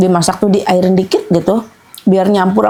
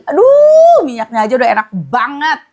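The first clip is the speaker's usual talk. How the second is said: delighted exclamation; 6 semitones higher than usual. Real, not acted. Tasting the oil, delighted.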